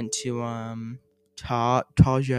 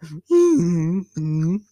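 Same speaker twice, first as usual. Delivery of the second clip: high odd mock laugh noise; imitated weird laugh; 5 semitones higher, 4 dB louder than usual. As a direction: copy the friends' strange laughing noises. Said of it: "making weird noises like".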